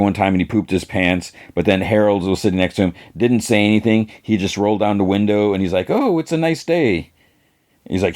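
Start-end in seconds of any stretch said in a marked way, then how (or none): 5.9-7.1 high, airy imitation; mock cheerful. Passenger rolls down the window, high mock-cheerful remark.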